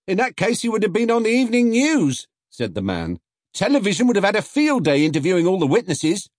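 fast, high, loud enthusing; eager man. Acted. Man enthusing to Paddington, fast and high. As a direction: rattle it off with relish.

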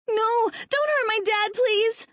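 put-on very high boy's desperate plea; high, frantic child voice; crying out. A narrator's reading. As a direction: beg for your father's life, pitched right up. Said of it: "Terry cried".

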